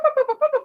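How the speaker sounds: string of high little boops; imitated booping noise. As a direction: rattle off high-pitched boops. Imitates sounds of dining room.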